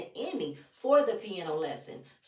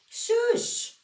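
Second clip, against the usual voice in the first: "Shush." much higher and louder, a sharp hushing; emphatic shush; 5 dB louder than usual. Real, not acted. Vlogger hushes Mama Joyce directly, in a high loud burst.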